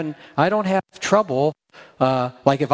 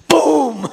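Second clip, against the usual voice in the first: loud high mock blast; explosion noise; 10 semitones higher, 6 dB louder than usual. Pastor mimics a short fuse blowing up. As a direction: burst out like a bomb going off.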